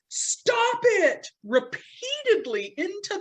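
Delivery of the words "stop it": high-pitched mock shout; imitated yell. Imitating Doctor Dan on the phone as the commercial airs, mock yell. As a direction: yell it like a movie hero's plea. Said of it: "as Doctor Dan yells".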